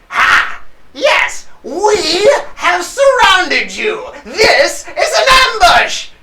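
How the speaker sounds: high, loud, drawn-out villain crow; gloating kobold bandit. Acted. Bandit springing an ambush on the party, high gloating shout. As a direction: announce the ambush in a high, triumphant yell.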